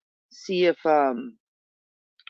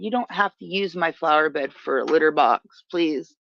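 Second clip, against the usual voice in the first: usual speaking voice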